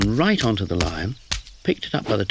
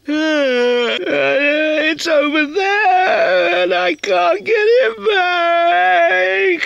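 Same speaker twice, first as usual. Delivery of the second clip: very high, loud, very slow crying wail; howling cry; 7 dB louder, much slower than usual. A narrator's reading. Kitten crying to Towser that her hat is gone. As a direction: sob and shout it out. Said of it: "howled Sadie".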